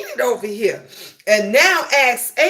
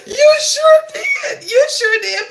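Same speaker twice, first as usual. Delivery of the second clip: excited squeal; 4 dB louder than usual. Real, not acted. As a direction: squeal it out to tease a friend. Teasing chat friend about pic.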